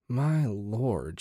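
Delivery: soft, weary sigh. A narrator's reading. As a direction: let it out on a sigh. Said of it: "she sighed".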